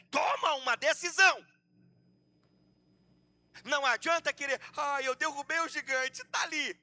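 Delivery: fiery preaching. Real, not acted. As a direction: press the congregation to decide, building in force. Preaching at full force.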